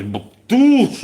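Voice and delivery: high, loud burst of a rocket hit; mouth-made explosion noise; imitated explosion. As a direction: voice the boom of an impact. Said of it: "American gym just goes".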